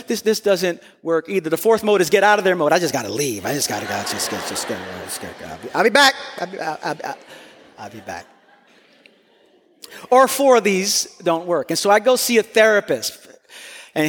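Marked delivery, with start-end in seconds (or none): 2.7-8.2 fading to a low mumble as he backs off; husband slinking away from the argument, mumbling ever softer; retreating mumble. Acted. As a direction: trail off quieter and quieter, edging out the door. Acting out walking away from conflict.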